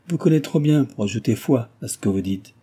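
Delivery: plain reading voice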